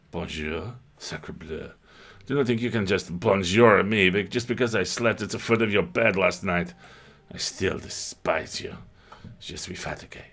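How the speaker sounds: a hint of French accent, sniffy cat; mock French cat. The cat scorns the player in a mock French accent.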